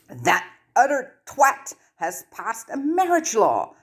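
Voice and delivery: slow, high outraged near-shout. Acted. Hermione near-shouts in outrage at breakfast. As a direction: Hermione almost shouting the news at the table. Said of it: "Hermione all but shouted".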